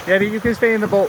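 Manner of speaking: loud call to the dog. Real, not acted. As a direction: shout it across to Yeti. Calling to the dog.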